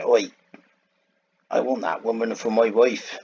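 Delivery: Samson in a put-on Western accent, shouted lightly; a mock rough Western-accented demand; strange Western accent. Samson demanding a wife from parents, put-on Western accent. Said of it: "in a strange Western accent".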